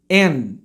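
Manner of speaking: low "And" with held d; true English pronunciation. Shown as a demonstration. Teacher's correct take, "And" without final vowel. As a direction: cut "And" off before the d releases. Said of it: "depois eu vou falar como se é".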